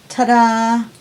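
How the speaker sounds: ordinary delivery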